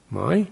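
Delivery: "Mai" with a rising, questioning lift. Shown as a demonstration. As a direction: slide "Mai" upward like a question. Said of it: "That sound means a question".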